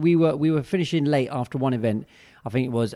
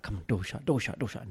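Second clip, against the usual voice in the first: much softer and lower, under the breath; muttering impatiently; 5 semitones lower, 10 dB quieter than usual. Retold or imitated. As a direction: mutter it to yourself, willing the doors shut. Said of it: "I'm like".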